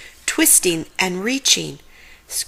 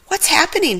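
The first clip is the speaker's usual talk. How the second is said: high, bewildered; confused groan; 10 semitones higher, faster than usual. Acted. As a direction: ask it lost and dazed. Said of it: "Shaggy groaned, confused".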